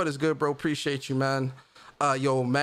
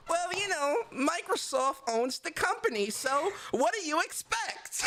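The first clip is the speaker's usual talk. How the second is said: mocking Twitter commenter; 11 semitones higher, 5 dB quieter than usual. Streamer mocks a Twitter comment for a soundbite, high sneering voice. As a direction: ham up a smug know-it-all from Twitter. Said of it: "the ones that people love to say on Twitter".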